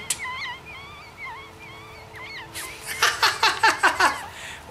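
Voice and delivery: a long, very high giggle that falls away; high squealing laugh. Real, not acted. Player cracking up at an ugly-cute alien, high giggle. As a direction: let out a squeaky delighted giggle.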